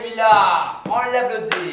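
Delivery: celui-là lancé bien plus fort et plus haut; félicitations enthousiastes. Real, not acted. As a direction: acclame la bonne réponse. Félicite l'enfant avec enthousiasme. (félicitations enthousiastes à l'enfant)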